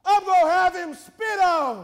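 high, shouted sermon cry; full-force preaching. Acted. Preacher voices God's plan for his son, full-force preaching.

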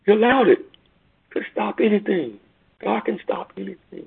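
high, forceful proclamation; emphatic declaration. Acted. Preacher insisting to mourners, high and forceful.

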